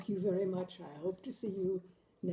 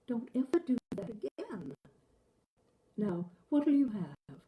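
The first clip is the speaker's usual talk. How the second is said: higher, louder voice of an anxious wife; higher, louder worried wife, slowing on the question; 4 semitones higher, 6 dB louder than usual. Acted. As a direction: press him like a wife who fears he nearly drowned. Wife scolds then moves on.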